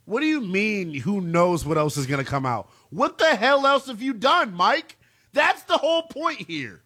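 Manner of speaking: incredulous, high-pitched rant; exasperated outburst. Acted. Host calls out the coach over his clip, exasperated. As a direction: demand answers, pitch leaping up in disbelief.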